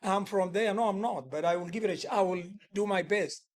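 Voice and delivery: ordinary talk, nothing marked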